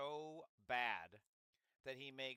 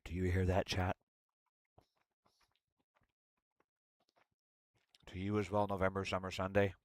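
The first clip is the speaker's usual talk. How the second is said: lower, louder, close to the mic; close-mic ASMR chat; 4 semitones lower, 12 dB louder than usual. Real, not acted. ASMR chatting to chat while eating an orange. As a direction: talk right into the mic over chewing.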